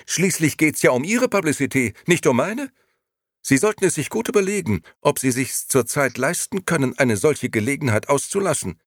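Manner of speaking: high, indignant scolding; loud, high-pitched lecture; heated retort. A narrator's reading. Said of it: "antwortete die Dame ebenso heftig".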